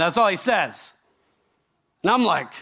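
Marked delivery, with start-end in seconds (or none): none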